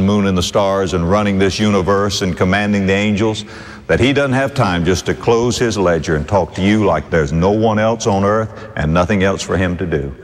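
ordinary talk, nothing marked

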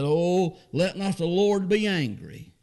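Abraham begging the Lord for mercy; Abraham pleading. Acted. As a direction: beg humbly, drawn out.

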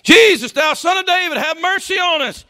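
sudden high, very loud plea; crying out. Acted. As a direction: cry for mercy at the top of your voice. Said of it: "They cry out to him".

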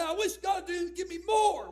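loud, high whining plea; mock complaining churchgoer. A preacher mocking a complaining member, loud and high. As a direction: whine it out like a needy believer. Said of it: "You say".

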